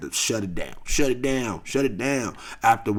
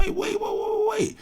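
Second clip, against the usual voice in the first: high, startled protest; shocked wait wait; stunned. Retold or imitated. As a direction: stop them in disbelief. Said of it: "I'm like".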